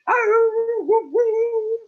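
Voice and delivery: high, loud howling woo-woos; a coyote howl passing as a bark; bad bark. Coyote practicing, howling instead of barking. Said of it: "That's not a bark".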